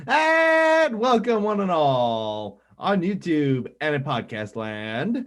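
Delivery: slow, grand announcer welcome; podcast intro. Acted. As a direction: draw out the welcome like a showman. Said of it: "Can I do the intro first".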